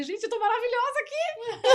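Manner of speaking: giggling fake pride; laughing mock boast. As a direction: gloat through laughter at yourself. Brags about holding coach face.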